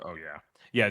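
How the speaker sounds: ordinary talk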